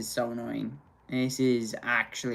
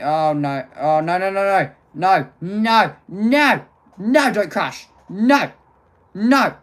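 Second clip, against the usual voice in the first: rising cries of no, louder and higher; panicked no; 6 semitones higher, 11 dB louder, much slower than usual. Real, not acted. Streamer watching the stream crash, rising panicked cries. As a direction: beg it not to crash, climbing in panic.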